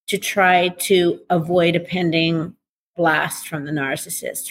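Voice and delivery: ordinary talk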